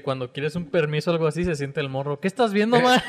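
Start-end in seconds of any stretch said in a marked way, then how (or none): 2.2-3.0 put-on sweet-talking kid. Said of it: "como el meme ese que dice".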